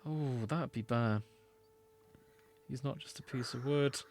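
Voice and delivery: usual speaking voice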